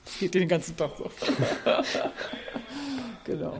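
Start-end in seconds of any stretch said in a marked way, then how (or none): 1.1-3.2 quiet chuckle; soft amused laugh. Real, not acted. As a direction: laugh it off softly. Laughs about constant announcements. (leises Lachen)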